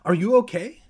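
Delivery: high, loud check-in; alarmed question. A narrator's reading. Andy checks on shocked Evan, loudly alarmed. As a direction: ask it urgently, loud and high.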